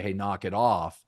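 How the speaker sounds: normal talking voice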